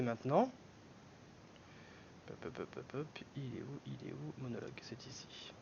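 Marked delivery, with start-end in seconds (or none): 2.3-5.5 low, half-whispered self-talk while searching; muttering to oneself. Real, not acted. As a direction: mutter it under your breath, barely voiced. Talks to himself while searching on screen. (marmonné tout bas, pour soi-même)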